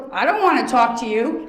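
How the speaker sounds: loud gruff mock brush-off; imitating him. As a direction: bark it like he did, half-joking. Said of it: "He goes".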